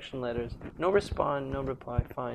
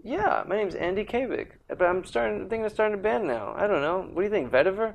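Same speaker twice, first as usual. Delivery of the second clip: lightly raised friendly imitation of Andy Kabik; imitating Andy Kabik; 8 semitones higher, 6 dB louder than usual. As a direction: play Andy Kabik introducing himself. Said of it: "That's how he talks".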